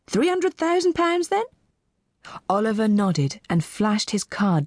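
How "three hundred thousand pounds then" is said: high-pitched questioning auctioneer; auctioneer's query. Acted. Auctioneer to new bidder, high query. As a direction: check the bid in a high voice. Auctioneer confirming the surprise bid.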